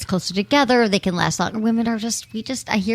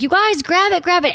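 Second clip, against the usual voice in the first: high, eager call to grab it; excited call at a party, urging the guests to grab it; 8 semitones higher than usual. Retold or imitated. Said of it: "I was like".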